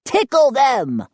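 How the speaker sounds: high-pitched gleeful gnome; excited high gnome voice; suggesting gnome. A narrator's reading. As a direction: pipe up eagerly with the idea. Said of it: "a gnome in front suggested".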